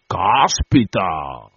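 slow, drawn-out amazed exclamation; astonished exclamation. Acted. Landlord reacts to the tirade, slow and amazed. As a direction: stretch it out on a big breath. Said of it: "tirando un gran respiro".

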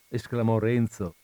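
plain reading voice

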